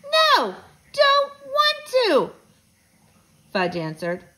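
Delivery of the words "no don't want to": put-on high, loud toddler refusal; slow, squealed bratty protest; stubborn little kid. A narrator's reading.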